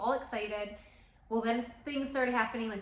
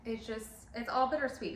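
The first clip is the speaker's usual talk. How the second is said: ordinary talk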